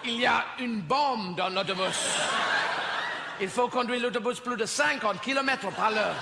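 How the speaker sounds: lively mock French commentator narrating; playful mock French play-by-play; mock French commentary. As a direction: gabble it like an excited French film narrator.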